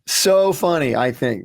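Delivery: ordinary talk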